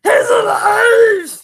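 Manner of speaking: high, loud, drawn-out shout; hammed-up, slow yell of creation come to life; triumphant cry. Streamer hails the revived camera, mock-triumphant shout.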